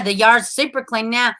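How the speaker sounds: ordinary delivery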